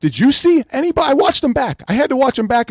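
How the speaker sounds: high indignant disbelief; exasperated. Real, not acted. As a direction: demand answers from the chat. Angry rant at reviewers.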